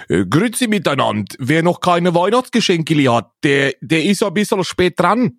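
imitating a Swiss radio host; Swiss German accent. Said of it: "da wurde dann gesagt".

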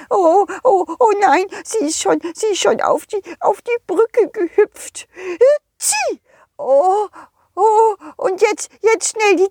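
high, worried snowman voice, slowing at the end; a little snowman afraid to lose his nose; panicky snowman. Acted. Snowman alarmed, sneezes. (hohe, ängstliche Schneemannstimme)

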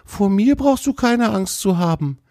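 high-pitched friendly deer voice; friendly young deer, reassuring. Acted. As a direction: reassure a shy stranger in a high animal-character voice.

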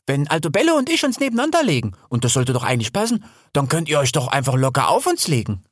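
high, chatty, hurrying in places; eager Hel the boar. Acted. (eifrig plaudernd, mit hoher Stimme)

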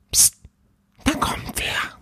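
soft, voiceless warning; alarmed whisper. Acted. Little ghost warns Paul someone is coming, urgent whisper. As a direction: hush them with an urgent whisper.

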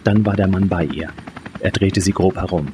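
plain reading voice

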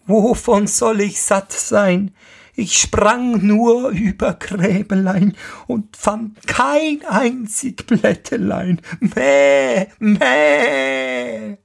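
hoch und meckernd wie eine Ziege, klagend; meckernde Ziege. Acted. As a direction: die freche Ziege jammert dem Vater etwas vor. Das boshafte Tier antwortet. (hohe, meckernde Ziegenstimme)

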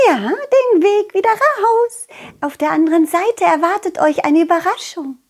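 high, bright little fairy voice; chirpy girl welcoming visitors; cheerful butterfly girl. Acted. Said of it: "Schmetterlingmädchen ... grüßte fröhlich".

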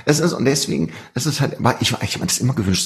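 usual speaking voice

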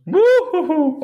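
sudden high choking splutter; choking on a drink. Real, not acted. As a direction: sputter as the drink goes down wrong. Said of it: "ich hab mich grad verschluckt".

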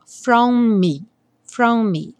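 from me with the two M's as one. Shown as a demonstration. Teacher shows from me with a single M. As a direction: merge the M's of from me. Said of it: "os dois M's vão soar um só".

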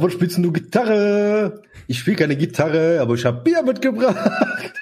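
mock dialogue. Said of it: "ich sag".

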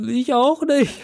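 put-on high, small child's voice; little brother's voice. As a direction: squeak it like a clueless little boy. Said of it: "Mein Bruder so". (hohe Kinderstimme des kleinen Bruders)

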